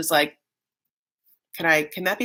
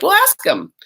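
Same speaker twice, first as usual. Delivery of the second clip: much higher mock sister; sister's voice; 12 semitones higher, 4 dB louder than usual. Sister urging Darcy to ask, high chirpy voice. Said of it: "the sister's like".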